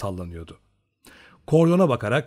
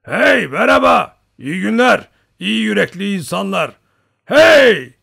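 big raised hailing call; shouting; 9 semitones higher, 11 dB louder than usual. A narrator's reading. Old hunter greeting the Cossacks from afar, shouting. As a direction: call across a river, loud and long. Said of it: "diye bağırdı kazaklara".

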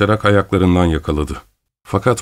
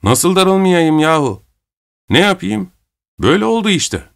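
angry shout; 10 semitones higher than usual. A narrator's reading. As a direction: cry out in indignation, pitch jumping up. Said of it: "Salakin öfkeyle bağırdı". (öfkeyle, tiz perdeden çıkışarak)

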